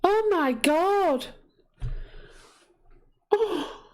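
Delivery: slow, high-pitched, choked-up gasp of joy; overwhelmed, tears rising; real tearful awe; happy tears. Real, not acted. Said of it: "Happy tears".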